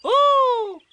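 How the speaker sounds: put-on a small child thrilled; excited. A narrator's reading. Roo thrilled by the story, high excited ooh. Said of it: "said Roo excitedly".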